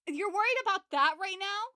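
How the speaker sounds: mock exasperated retort; incredulous. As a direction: scoff at her priorities loudly.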